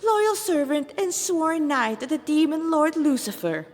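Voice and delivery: flamboyant demon lieutenant. Acted. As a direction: show off your rank to the cornered humans.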